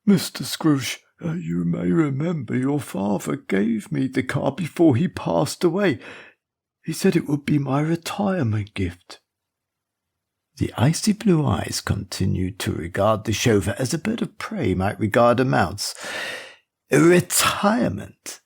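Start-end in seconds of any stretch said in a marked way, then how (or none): 0.0-9.2 put-on higher, hesitant plea of an elderly servant; anxious old chauffeur. 16.9-18.4 slow, high, rising incredulous question through a forced smile. A narrator's reading. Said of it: "through a forced smile".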